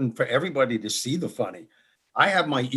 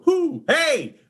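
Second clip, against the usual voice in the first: high hooting owl, laughing through it; a silly owl popping up in the outhouse, high and hooting; 13 semitones higher, 4 dB louder than usual. Pretend. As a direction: hoot it out like a cheeky owl. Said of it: "the owl going".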